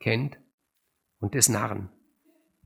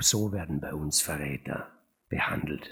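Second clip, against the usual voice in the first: low, unvoiced cruel whisper; a villain gloating in a low whisper; menacing whisper; 8 semitones lower, 3 dB quieter than usual. A narrator's reading.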